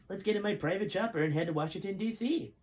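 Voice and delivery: rally everyone to the chopper; proclaiming general. Acted. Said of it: "he proclaimed".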